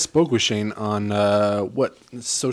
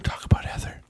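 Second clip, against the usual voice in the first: hushed joking aside; whispering. Real, not acted. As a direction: whisper it conspiratorially. Whispered joke about wrong name.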